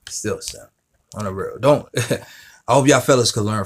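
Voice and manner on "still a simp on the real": dropped to a near-whisper, much softer; soft aside. Shown as a demonstration. A quiet aside calling him a simp, to the viewers. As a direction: tuck it in under the breath. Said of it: "but still a simp".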